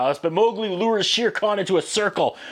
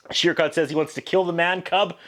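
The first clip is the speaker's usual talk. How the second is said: ordinary talk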